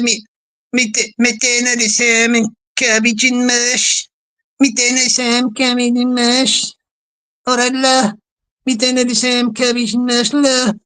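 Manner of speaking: put-on Liverpudlian accent; Quaver Raver. Imitating viewer in the kitchen making dinner, in Scouse. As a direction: have a playful go at broad Scouse. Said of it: "I'll see if I can do this Liverpool accent".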